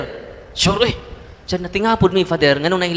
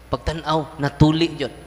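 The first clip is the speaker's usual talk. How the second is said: ordinary delivery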